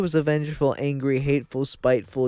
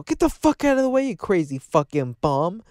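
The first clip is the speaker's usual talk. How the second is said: much higher, loud, fast yell; mock angry passerby; 7 semitones higher, 3 dB louder than usual. Passerby yelling at Jesus, higher mock yell. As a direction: bark it at the bum. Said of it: "somebody's gonna walk by and go".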